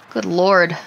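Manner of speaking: ordinary talk, nothing marked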